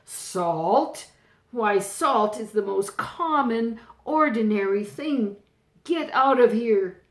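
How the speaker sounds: furious king banishing his youngest daughter. Acted. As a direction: rage at the daughter and throw her out. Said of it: "he became furious".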